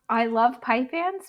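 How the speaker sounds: ordinary talk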